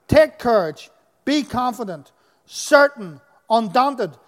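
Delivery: loud, rousing scripture proclamation; preaching at full force. Acted. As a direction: lift the congregation, declaring every word.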